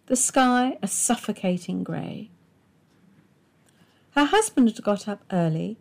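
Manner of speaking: ordinary delivery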